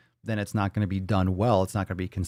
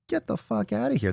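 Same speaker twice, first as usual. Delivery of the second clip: high mock dismissal; 12 semitones higher than usual.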